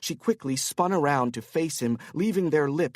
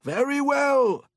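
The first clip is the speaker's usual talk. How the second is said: tipsy Korean businessman; 12 semitones higher, 5 dB louder than usual. Acted. Korean man character voice.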